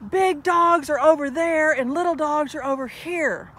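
retelling a stranger yelling at a couple at the dog park; retold yelling. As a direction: imitate the angry man shouting orders. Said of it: "he starts yelling".

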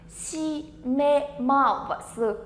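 "Shimemasu" very slow, each syllable held apart. Shown as a demonstration. Teacher introducing word number four to learners, syllable by syllable. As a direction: spell out "Shimemasu" sound by sound.

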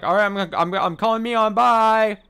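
high, loud hammed-up imitation; mock hurried Rina. Player mocks Rina rushing off to call Mion, hammed-up and loud. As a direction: stammer into an exaggerated, shouted goodbye. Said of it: "and then she's like".

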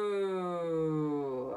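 long gliding siren "ooh"; big sun ooh. Shown as a demonstration. Teacher warming up voices, long gliding ooh. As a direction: slide the ooh slowly like the sun's path. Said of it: "Do it again".

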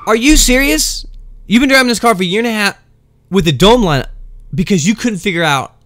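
you can't believe what you just heard; mock outraged disbelief; incredulous. Disbelief at coworker.

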